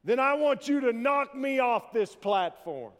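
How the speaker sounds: high, loud shout flung at the ceiling; taunting shout. Acted. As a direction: professor shouting a challenge up to God. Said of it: "he shouted".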